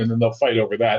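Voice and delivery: ordinary talk, nothing marked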